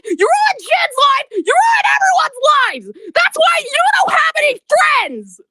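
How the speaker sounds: sobbing, shouting high; crying shout. Real, not acted. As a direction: yell it through tears. Crying outburst at the bully.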